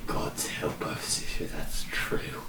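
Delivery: slow, hushed whisper. Acted. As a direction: breathe it out like a dread prayer. Grim aside after the news.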